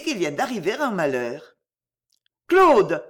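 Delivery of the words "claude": put-on loud, higher-pitched cry of the child's name; alarmed cry. A narrator's reading. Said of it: "s'écria Ghislaine".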